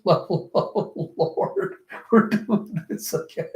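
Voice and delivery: breaking into laughter mid-sentence; laughing. Real, not acted. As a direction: talk through helpless giggles. Laughs through own reaction.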